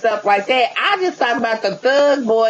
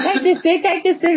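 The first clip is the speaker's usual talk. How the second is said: mock high voice; 7 semitones higher than usual. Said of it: "you're like".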